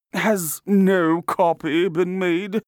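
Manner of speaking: slow, loud, high-pitched query; demanding artist. A narrator's reading. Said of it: "he demanded".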